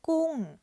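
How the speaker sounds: gung loud, starting high and falling; Thai falling tone. Shown as a demonstration. Teacher modelling gung with a falling tone. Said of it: "falling tone".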